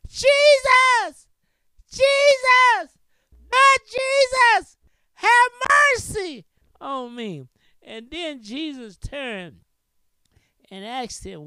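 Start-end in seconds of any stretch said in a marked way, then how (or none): 0.1-7.5 high, loud desperate plea; blind man crying out. Acted. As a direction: cry out to Jesus for mercy, drawn out. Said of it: "He cried the more".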